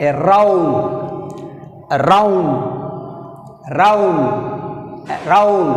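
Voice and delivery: aROUND, ROUND rising and strong; teacher drilling aROUND, stress on ROUND. Shown as a demonstration. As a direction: model aROUND, lift on ROUND. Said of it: "rising sound round".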